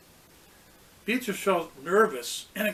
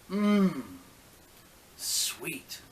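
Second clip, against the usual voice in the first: savoring taste. A narrator's reading. Tasting the milk.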